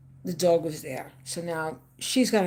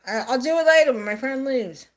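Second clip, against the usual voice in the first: loud, higher, mock-lazy teenage excuse; son's brush-off; 6 semitones higher, 7 dB louder than usual. Mother imitating her grown son putting off the chore, a loud lazy brush-off. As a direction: toss off the chore like a sulky son. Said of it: "it was like".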